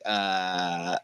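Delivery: low, soft, stretched-out hesitation; awkwardly standing by. Retold or imitated. Imitating the ignored woman standing there, awkwardly lost. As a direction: imitate someone left trailing off. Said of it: "just standing there like".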